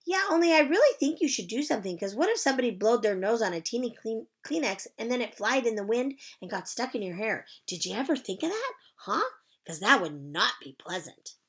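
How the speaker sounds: put-on high, bright child's greeting; chirpy little girl. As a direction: sing it out like a cheerful kindergartner. Junie B. character voice.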